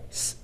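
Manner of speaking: "s" as an isolated voiceless hiss; letter sound. Shown as a demonstration. Teacher modelling the sound in "so", hissed "s". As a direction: hiss "s" by itself. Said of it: "same sound as the letter s".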